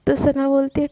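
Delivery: plain reading voice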